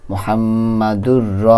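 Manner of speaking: slowly drawn-out recitation of মুহাম্মাদুর র; slow spelled demo. Shown as a demonstration. Teacher showing students the reading, slowly. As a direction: stretch each sound of মুহাম্মাদুর র. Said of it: "শুধু এখানে হরকতের উচ্চারণটা নেওয়া হয়".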